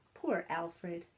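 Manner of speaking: ordinary delivery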